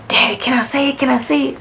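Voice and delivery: put-on high, pleading little girl; eager child. Young daughter begging dad to let her curse, high and eager. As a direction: beg eagerly, twice.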